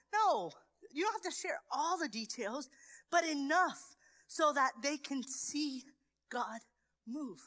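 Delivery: normal talking voice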